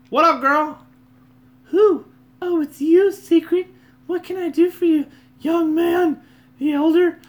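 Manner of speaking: squeaky high village woman; loud high-pitched old lady character; high NPC lady voice. Acted. Voicing village woman NPC dialogue.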